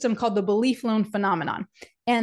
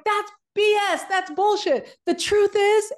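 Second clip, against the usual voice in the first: much higher, louder motivational shout; pumped-up coach; 10 semitones higher, 4 dB louder, slower than usual. Retold or imitated. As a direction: call it out like a fired-up speaker. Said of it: "then he's like".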